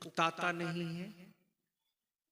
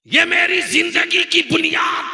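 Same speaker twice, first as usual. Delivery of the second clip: angry retort; 13 semitones higher, 19 dB louder than usual. Retold or imitated. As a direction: hammer each word as the enraged cleric. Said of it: "गुस्से में बोल पड़ा".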